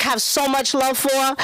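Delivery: usual speaking voice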